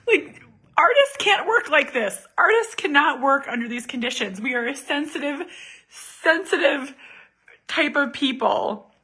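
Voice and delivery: high, hammed-up fragile artiste; mock sensitive artist. Mom mocking herself as a sensitive artist.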